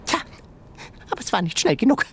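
high, dry animal retort; tiny creature voice. A narrator's reading. Sengi retorting to Ben, high creature voice. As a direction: shoot back like a smug critter.

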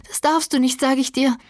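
high, insistent command; desperate insistence. A narrator's reading. Catherine holds Heathcliff back with all her strength, insistent. Catherine forbids him to leave.

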